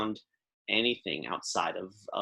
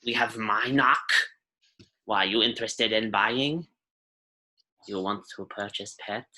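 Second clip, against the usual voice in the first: put-on cranky old alien lady in broken Basic; old shopkeeper voice, grumbling; old Rhodian shopkeeper. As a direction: threaten then warm up, in character. Shopkeeper character voice.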